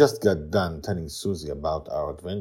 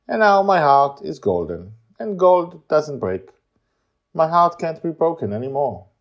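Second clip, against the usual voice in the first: put-on monotone child voice; 10 semitones higher, 8 dB louder than usual. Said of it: "talking in a monotone voice".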